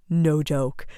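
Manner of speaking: ordinary delivery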